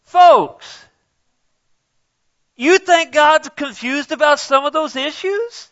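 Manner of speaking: high, loud rhetorical cry; preaching at full force. Real, not acted. Preacher challenging the congregation, high and loud.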